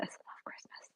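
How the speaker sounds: breathy dreamy whisper. Acted. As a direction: whisper it like a secret delight. Reads the synopsis dreamily.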